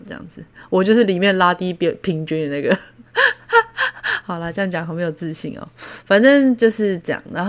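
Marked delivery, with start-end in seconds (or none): 3.1-4.2 high self-mocking laugh. Real, not acted. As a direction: laugh at yourself, squeaky. 自嘲后大笑. (自嘲的高声笑)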